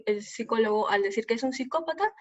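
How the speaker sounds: ordinary talk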